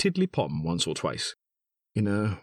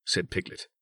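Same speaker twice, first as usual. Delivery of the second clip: usual speaking voice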